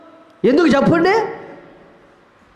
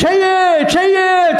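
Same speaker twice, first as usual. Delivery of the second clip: mock calling out; 9 semitones higher, slower than usual. Mock nagging to bathe.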